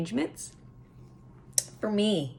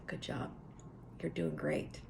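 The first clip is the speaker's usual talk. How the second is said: soft self-praise; 2 semitones lower, 15 dB quieter than usual. Shown as a demonstration. Private self-talk encouragement.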